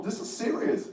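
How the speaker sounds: whispered in sudden fright; scared whisper. Retold or imitated. Patient to his doctor, a frightened whisper. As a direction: breathe it out, no voice, scared. Realising he may die.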